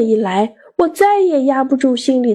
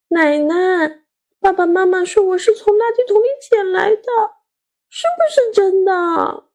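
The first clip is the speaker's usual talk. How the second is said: high-pitched anxious appeal to grandma; pleading child; 9 semitones higher than usual. A narrator's reading. Child asking grandmother if it's true, pleading. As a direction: beg for confirmation in a high child voice. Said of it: "我跑到客厅向奶奶求证".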